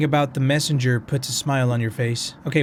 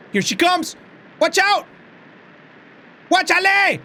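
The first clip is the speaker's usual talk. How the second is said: high, loud frightened yelling; panicked yell; 5 dB louder, slower than usual. Real, not acted. Player warning himself as the ghost rushes in, panicked yell. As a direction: yell in real fright as she comes at you.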